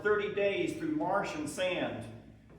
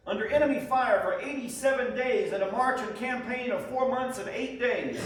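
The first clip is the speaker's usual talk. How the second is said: louder and higher, proudly announcing the victory; triumphant proclamation; 6 semitones higher, 7 dB louder than usual. Acted. Soldier proudly writing home that Atlanta has fallen, triumphant and loud. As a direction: ring it out like news of a great victory.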